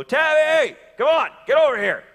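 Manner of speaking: high loud call; yelling out the window. Retold or imitated. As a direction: call the dog from a truck. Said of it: "yelling out the window".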